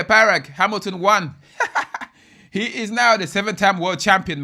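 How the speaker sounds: loud, bright-eyed race-win call breaking into a laugh; excited finish call. Real, not acted. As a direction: shout the winner like the race just ended. Race finish, winner announced.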